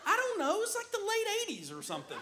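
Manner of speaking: high laughing quick wife voice; mock wife voice, laughing; teasing wife. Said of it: "And she's like".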